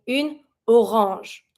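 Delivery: une orange with a nasal an; French une orange. Shown as a demonstration. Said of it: "we're gonna say".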